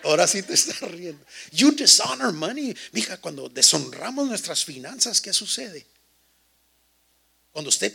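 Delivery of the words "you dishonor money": much higher, louder punch line; preaching shout. Real, not acted. Pastor shouts the point to his congregation, much higher and louder. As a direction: call it out to the whole church.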